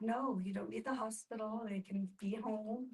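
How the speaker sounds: ordinary talk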